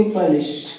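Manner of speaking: पुलिस as puh-LISH; short "puh" opening on पुलिस; corrected pronunciation. Shown as a demonstration. Teacher telling students to say it straight, corrected. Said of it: "generally सीधे बोलेंगे".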